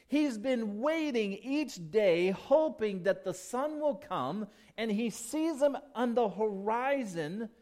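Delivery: high, loud, fervent preaching; preaching at full force. Acted. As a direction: swell into passionate preaching. Preaching the prodigal father.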